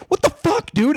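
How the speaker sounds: high, indignant mock outrage; retold angry outburst. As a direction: friend snapping at Fred after the apology, high and indignant. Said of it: "And I was mad at him".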